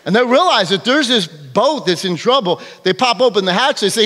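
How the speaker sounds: ordinary delivery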